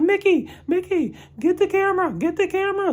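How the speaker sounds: very high, loud, urgent calling; frantic reporter impression. Podcaster imitating Jack Ryder calling his cameraman, high frantic shout. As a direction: squeal it urgently like a panicked reporter.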